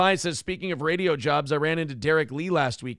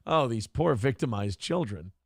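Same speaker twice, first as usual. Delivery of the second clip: lower, softer, dry sarcasm; mock pity, deadpan; sarcastic; 6 semitones lower, 4 dB quieter than usual. Real, not acted. Host mocking the claim that the boys are victims, dry. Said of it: "The fuck is going on?".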